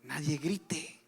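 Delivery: soft, slow quiet command; hushed order. Retold or imitated. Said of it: "Josué le dijo al pueblo". (orden en voz baja y lenta)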